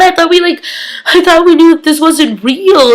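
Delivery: high, incredulous protest; mock disbelief. Viewer reacting to the staged breakup, acted-out incredulity.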